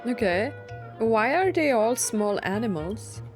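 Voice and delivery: normal talking voice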